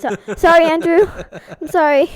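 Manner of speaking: loud, high, real laughter through the words; laughing apology. Real, not acted. Apologizing to the roommate mid-question, laughing loudly. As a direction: barely get it out for laughing.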